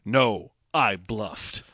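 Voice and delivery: low, soft, very slow robot; robot reveal. Acted. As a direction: draw it out deep and quiet as a robot. Acting the robot revealing its bluff.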